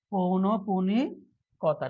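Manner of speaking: পৌনঃপুনিকতা drawn out very slowly; slow dictation. Shown as a demonstration. Teacher repeating the answer for students, slow and drawn-out. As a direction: stretch out পৌনঃপুনিকতা for learners.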